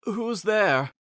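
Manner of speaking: lightly voiced dying king calling. A narrator's reading. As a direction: cry out into the rain.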